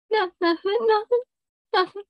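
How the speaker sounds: high, squeaky denial from the caught sister; flustered sister denying. Retold or imitated.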